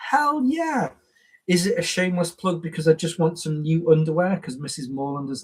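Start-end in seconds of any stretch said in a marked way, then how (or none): 0.1-0.9 high, bright mock enthusiasm; a high-pitched, overeager yes; eager hell yeah. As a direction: jump at the offer, squealing with glee. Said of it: "I'm like".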